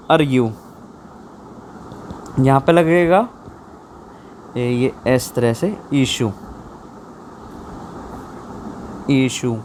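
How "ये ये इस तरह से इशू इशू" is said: softer, slow इशू with clear sh and long u; careful model word. Shown as a demonstration. Teacher showing students how issue is said, slow and soft. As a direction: say issue slowly and softly, like dictation. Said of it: "लिख के बताया तो कैसे लिखा जाता है इशू".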